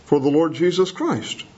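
usual speaking voice

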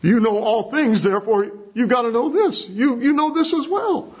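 high, pressing acted plea; insistent appeal. Peter's appeal acted out emphatically.

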